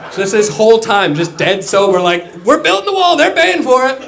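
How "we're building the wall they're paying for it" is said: loud, much higher rally chant; crowd-pleasing campaign boast; mock Trump rally. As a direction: bellow it to a cheering crowd.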